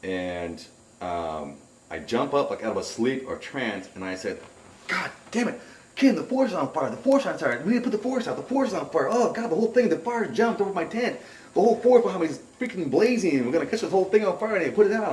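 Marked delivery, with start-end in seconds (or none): 4.9-15.1 high-pitched, panicked alarm, acted out; freaking out. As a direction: retell a frantic fire warning, words tumbling out high. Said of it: "I'm all freaking out".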